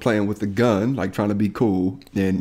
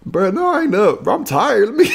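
high, whiny mock tired complaint; hammed-up sleepy protest; groggy friend; 12 semitones higher, 4 dB louder than usual. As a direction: imitate yourself woken up too early. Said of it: "Hey, you up?".

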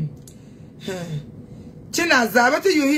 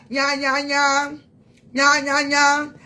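mocking nya nya; 3 semitones higher, much slower than usual. Real, not acted. Mocking the rival fans with a sing-song nya nya. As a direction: tease like a playground bully. Childish taunting noise.

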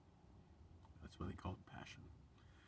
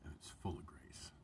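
tender whisper; 8 semitones lower, 12 dB quieter than usual. Real, not acted. Tender closing blessing to viewers.

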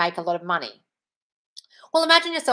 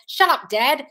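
louder and higher, snapping at Dad; mock yell; 6 semitones higher, 5 dB louder, slower than usual. Shown as a demonstration. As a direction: shout down the old voice in your head. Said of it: "I'm gonna yell".

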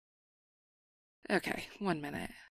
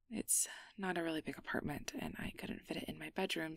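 softer, sheepish whisper; 7 dB quieter, faster than usual. Acted. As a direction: explain it under your breath. Sheepish explanation.

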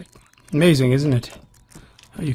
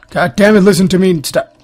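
loud, higher exasperated shout; frustrated yell; 5 semitones higher, 10 dB louder, faster than usual. Real, not acted. Gamer yelling at his game to stop. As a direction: yell at it to finally listen.

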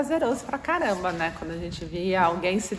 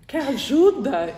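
higher, mock-sincere offer of help; fake concern; 6 semitones higher, slower than usual. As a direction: pretend to care while hiding a laugh. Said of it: "Começa a fingir que tá séria".

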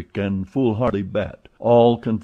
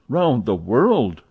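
louder, slow astonished cry; 3 semitones higher, 5 dB louder, much slower than usual. Acted. Fix crying out at Passepartout's news, louder and slow. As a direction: draw it out, astonished. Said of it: "cried Fix".

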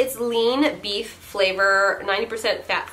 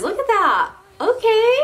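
excited; 12 semitones higher, 5 dB louder than usual. Real, not acted. Excited about leash.

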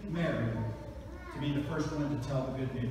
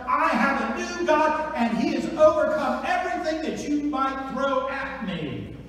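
higher, louder defiant taunt; defiant to Satan; 8 semitones higher, 6 dB louder than usual. Acted. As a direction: stare down the enemy and triumph. Defiant words spoken to Satan.

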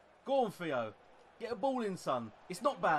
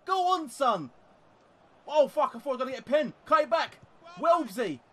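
much higher and louder, urging the players on; frantic yelling; 11 semitones higher, 9 dB louder than usual. Real, not acted. Gamer urging his players on mid-match, frantic yelling. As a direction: scream at the screen as the attack builds.

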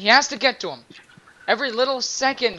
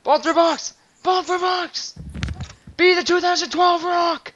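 cheering shout; 10 semitones higher, slower than usual. Real, not acted.

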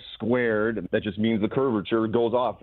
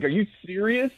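incredulous; 11 semitones higher than usual. Retold or imitated. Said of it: "and it's like".

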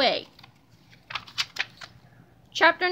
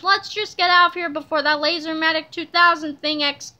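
usual speaking voice

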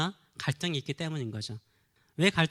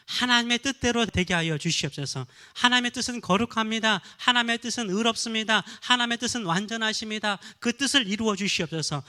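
louder, higher fervent prayer recitation; 5 semitones higher, 4 dB louder than usual. Acted. Pastor modelling a prayer for the congregation, loud and fervent. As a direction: pray it aloud with full fervor.

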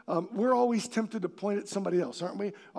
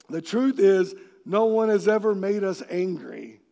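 normal talking voice

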